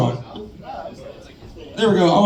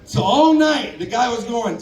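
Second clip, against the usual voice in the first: ordinary talk, nothing marked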